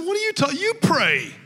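high, loud, laughing disbelief; incredulous outburst. Retold or imitated. Shocked young man telling the preacher to pray himself, loud and laughing. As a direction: throw it back at him in shocked disbelief.

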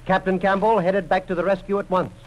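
ordinary talk

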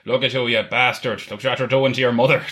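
loud, mock-angry telling-off; scolding father. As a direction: play an exasperated father berating his son. Imitated father scolding his son.

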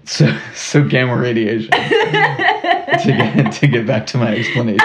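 giggling through the words; breaking into little giggles; hiccup giggles. Real, not acted. Giggling fit after cut-out aside.

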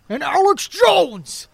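loud, high, drawn-out ranting impression; Alex Jones. Showing off his one good voice, loud ranting impression. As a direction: bellow the name slowly like a ranting host. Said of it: "you do a pretty solid Alex Jones".

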